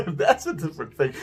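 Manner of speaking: quick line through a laugh. Real, not acted. Speaker laughing at his own accidental accent, amused. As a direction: chuckle as you say it.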